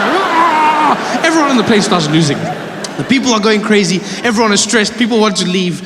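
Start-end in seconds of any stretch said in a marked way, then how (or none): 0.1-1.2 high, drawn-out comic scream; hammed-up panicked yell; mock scream. Passenger's panicked scream, acted.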